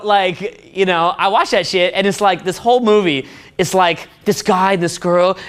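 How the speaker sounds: normal talking voice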